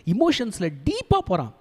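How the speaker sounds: usual speaking voice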